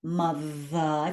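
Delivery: mother slow and low, buzzing on the th; stretched mother, humming th; voiced th. Shown as a demonstration. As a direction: make the th in mother vibrate in the throat. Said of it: "it's a sounded consonant".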